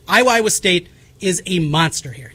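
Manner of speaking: ordinary delivery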